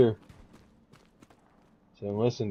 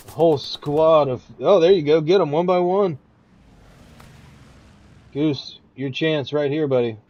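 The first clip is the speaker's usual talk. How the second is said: rising into a loud excited call; excited play-by-play; 4 semitones higher, 6 dB louder than usual. Real, not acted. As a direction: build up from eager to shouting.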